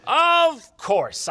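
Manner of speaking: high sarcastic "of course"; mock the narrator with a knowing sneer. Mocking the narrator's claim, sarcastic.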